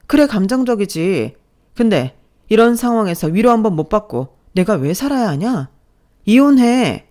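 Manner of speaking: building to a loud, high shout; furious outburst. Acted. Wife shouting at her husband that she wants a divorce, furious. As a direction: let it all out and scream for divorce.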